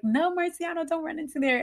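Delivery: high, urgent retold protest at normal volume; retold alarmed plea. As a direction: imitate a mom calling her child back. Retold mother calling child back.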